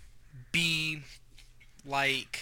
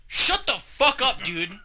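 much higher mock shout; retold angry yell; 9 semitones higher, 4 dB louder, slower than usual. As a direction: snap it like the fight is starting. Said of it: "I said".

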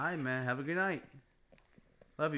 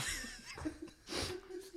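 high breathy laugh; 4 dB quieter than usual. Real, not acted. Laughing hard.

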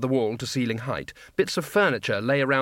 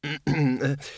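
a gruff, deliberate throat clear. Acted. Arch-Chancellor clears his throat before warning the wizards. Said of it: "The Arch-Chancellor cleared his throat".

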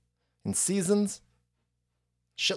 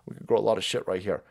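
low confident farmer; 8 semitones lower, 5 dB quieter than usual. Retold or imitated. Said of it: "were like".

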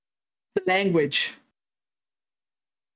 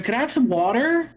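higher-pitched American pronunciation of water; American accent; 4 semitones higher than usual. Retold or imitated. Customer re-asking for water after a blank look, American accent. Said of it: "you do a great American accent".

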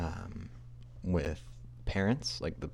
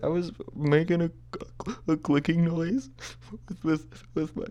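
put-on high, blubbering child explaining himself; hammed-up crying, stammering through the words; mock tearful; 11 semitones higher than usual. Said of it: "I burst into tears".

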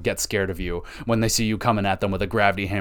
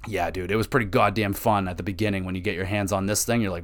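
usual speaking voice

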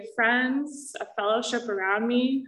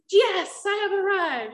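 mock triumph; 10 semitones higher than usual. Telling about the psych ward, mock triumphant cheer. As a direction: cheer it like a sarcastic win. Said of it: "And I was like".

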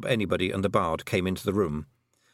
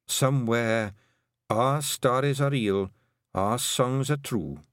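dying words; 3 semitones higher, slower than usual. A narrator's reading. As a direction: let each phrase fade like a final breath. Dying kelder's last words.

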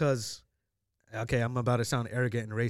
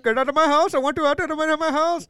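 put-on high, loud Indian-accented rant; mock Indian-accented shout; 14 semitones higher, 8 dB louder than usual. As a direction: imitate an angry homeowner's Indian accent, yelling. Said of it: "He sounded just like that".

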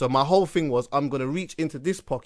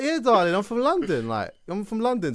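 put-on higher, playful English accent; 7 semitones higher than usual. Telling the stripper he is from London, in a playful English accent. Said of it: "I'm using my English accent to the T now".

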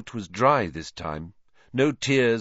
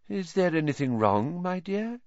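misty seer voice; 9 semitones higher than usual. Acted. Trelawney mystic voice.

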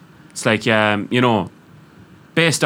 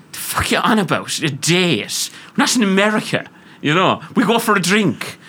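scornful Irish woman; 6 semitones higher than usual. Retold or imitated. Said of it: "Irish women would turn around and go".

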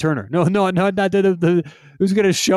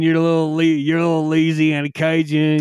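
Louisiana Cajun. Pretend. Cohost shows off his Louisiana Cajun accent. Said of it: "that's the first one that was different".

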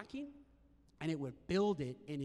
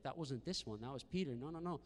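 ordinary talk

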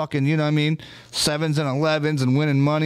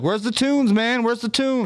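imitating demanding friends; 10 semitones higher, slower than usual. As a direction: call out for the tunes in a teasing voice. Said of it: "They'd be like".

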